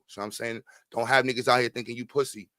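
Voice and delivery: ordinary talk, nothing marked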